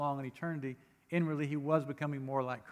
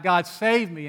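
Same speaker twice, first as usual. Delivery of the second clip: loud, pleading cry; a desperate psalmist crying out loud; crying out to God; 3 semitones higher, 9 dB louder, slower than usual. Retold or imitated. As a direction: call out to God for rescue. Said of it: "And he cried out".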